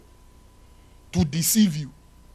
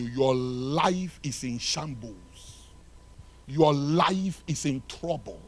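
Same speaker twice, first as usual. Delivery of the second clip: slow grave warning; 3 semitones lower, 4 dB quieter, slower than usual. Acted.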